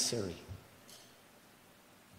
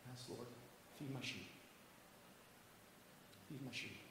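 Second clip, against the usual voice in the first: hushed dialogue; 2 semitones lower, 24 dB quieter than usual. Acted. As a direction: drop right down to a hush. Acts out Jesus and Peter's exchange.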